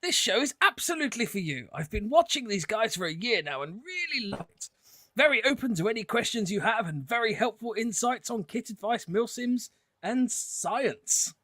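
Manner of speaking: high, plummy posh English voice; posh accent. Acted. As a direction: read it like an upper-class gent. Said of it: "He definitely needs a posh accent".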